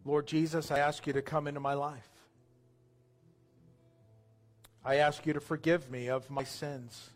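subdued prayer in short phrases, long pauses between; slow guided prayer. Real, not acted. Pastor leading new believers through a prayer, slow and subdued. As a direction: pray slowly, leaving room for others to repeat. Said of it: "I'm gonna pray slow".

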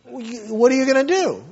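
ordinary delivery

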